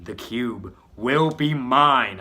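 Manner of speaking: loud, slow, drawn-out villain boast; villain yell. Acted. Villain breaking the cuffs, slow loud yell. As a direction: bellow the claim slowly like a villain. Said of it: "I yell".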